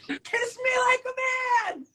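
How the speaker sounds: high, loud mock shout of the girl; scolding girl. The date scolding the friend after the kiss, high mock shout. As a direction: belt it out as the annoyed date.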